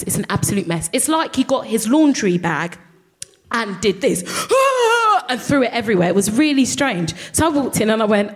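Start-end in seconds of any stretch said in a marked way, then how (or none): 4.3-5.2 high sharp gasp; high mock gasp. Acts out the mess.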